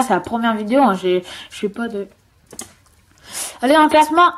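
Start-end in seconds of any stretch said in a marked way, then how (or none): 3.6-4.3 loud, high urging cheer; excited cheer. Real, not acted. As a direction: cheer the game on. Cheering self on in game. (encouragement enthousiaste)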